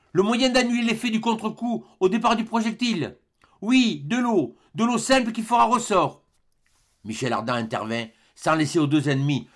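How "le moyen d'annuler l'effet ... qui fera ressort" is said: high-pitched, elated inventor; excited explanation. A narrator's reading. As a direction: pour out the discovery in a high, eager voice. Inventor excitedly explaining his discovery. (explication exaltée, voix haut perchée)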